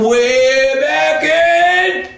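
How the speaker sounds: stretched slow, pitch climbing high; drawn-out windup. A narrator's reading. Storyteller winding up for the kick, slow rising suspense. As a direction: draw the words out like pulling back a slingshot. Drawing the feet back to kick.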